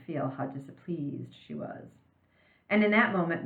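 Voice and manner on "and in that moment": plain reading voice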